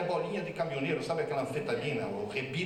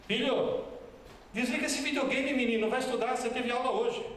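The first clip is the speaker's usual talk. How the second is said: high, insistent mom voice ordering her son to study; high-pitched mock scolding mom; scolding mother; 8 semitones higher than usual. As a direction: play a mother nagging her kid off the videogame. Mother scolding son over videogame.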